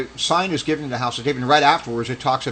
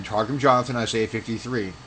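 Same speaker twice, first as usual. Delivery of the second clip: normal talking voice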